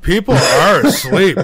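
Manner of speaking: says it through a real laugh. Real, not acted. Telling a friend people are asleep, laughing. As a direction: laugh it out, amused at them.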